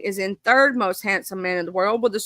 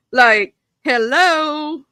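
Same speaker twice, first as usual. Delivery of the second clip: high laugh into a slow, drawn-out hello; laugh, then stretch the hello slowly; 5 semitones higher, 4 dB louder, much slower than usual. Real, not acted. Laughing at fine men.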